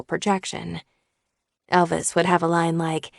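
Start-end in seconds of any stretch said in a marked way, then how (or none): none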